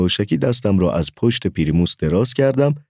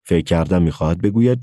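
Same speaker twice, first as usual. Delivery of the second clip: ordinary delivery, nothing marked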